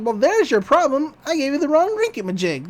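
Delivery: a hint of excitable cartoon old man; McGucket. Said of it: "McGucket's like".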